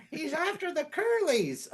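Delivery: high, slow, laughing spooky voice; mock villain voice. Friend mocks the neighbor's fear in a silly sinister voice. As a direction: put on a giggly sinister voice for the joke.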